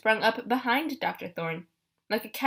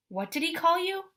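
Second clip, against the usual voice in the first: plain reading voice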